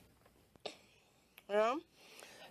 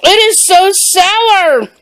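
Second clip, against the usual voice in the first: drawn-out, high, puckered "so sour"; puckered sour reaction; 7 semitones higher, slower than usual. Real, not acted. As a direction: wince through the sourness, slowly.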